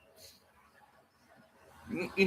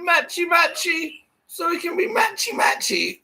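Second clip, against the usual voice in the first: mocking high voice; 12 semitones higher than usual. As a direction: sneer at the phrase in a squeaky voice. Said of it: "Who talks like that?".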